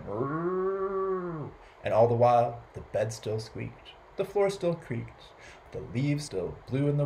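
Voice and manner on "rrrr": long, high rounded moo; cow mooing. A narrator's reading. Storyteller voicing the cow in the yard, long moo. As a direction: imitate a cow with one sustained moo. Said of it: "and the cow going".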